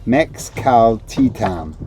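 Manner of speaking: "Mexcaltitan" slowly, syllable by syllable. Shown as a demonstration. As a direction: spell out "Mexcaltitan" in slow, separate syllables. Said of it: "Now we're gonna say it slowly".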